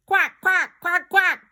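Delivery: high, loud squawking quacks; panicked duck cries; duck quacking. Acted. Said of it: "cried Ping".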